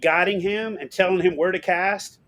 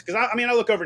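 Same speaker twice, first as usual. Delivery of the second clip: ordinary talk, nothing marked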